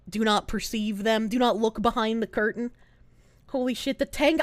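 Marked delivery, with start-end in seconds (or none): none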